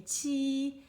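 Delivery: chi held high and level, drawn out slowly; first tone. Shown as a demonstration. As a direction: sing chi flat and high like a steady note. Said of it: "I say".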